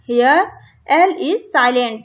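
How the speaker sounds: reading English instructions slowly, word by word; slow textbook reading. Shown as a demonstration. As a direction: read it out deliberately for the class. (किताब से धीरे-धीरे पढ़ना)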